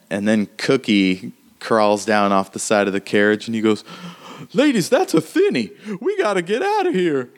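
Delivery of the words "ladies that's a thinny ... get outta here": high alarmed voice. Acted.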